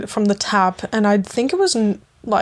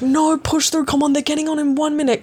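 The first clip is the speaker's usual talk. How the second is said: friend's voice pitched high and fast, pushing her on; urging friend; 6 semitones higher than usual. Retold or imitated. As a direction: egg her on like the friend did.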